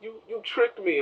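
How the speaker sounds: high-pitched tearful whine, hammed-up; mock crying. Hector to the woman who tricked him, mock crying. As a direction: imitate a betrayed man crying. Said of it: "he's crying".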